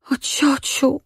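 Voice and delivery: high, choked "O ciociu"; girl cries out to her aunt, choked; choked cry. A narrator's reading. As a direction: call out with a catch in the throat. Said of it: "zawołała zdławionym głosem".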